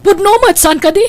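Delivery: loud, high accusing jab; a wife confronts her husband in a quarrel, loud and high; angry. Acted. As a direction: throw the accusation at him.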